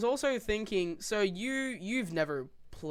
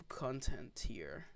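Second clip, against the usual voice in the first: whispering; 5 semitones lower, 10 dB quieter, much slower than usual. Real, not acted. Whispered trailing question.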